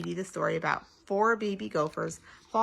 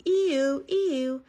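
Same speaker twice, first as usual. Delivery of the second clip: high two-note siren imitation; loud, high eee-ooo; fire engine siren; 7 semitones higher, 5 dB louder than usual. A narrator's reading. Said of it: "fire engine came down the street going".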